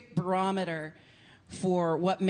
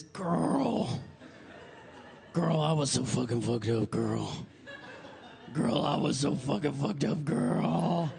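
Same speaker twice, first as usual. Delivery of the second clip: low, raspy camp drawl; wasted party boy drawling; 3 semitones lower, slower than usual. Retold or imitated.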